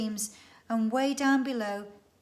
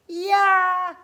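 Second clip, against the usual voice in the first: high, louder echoed yeah; loud echo shout; 9 semitones higher, 12 dB louder than usual. Acted. The echo shouting yeah back at Karen. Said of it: "said the echo".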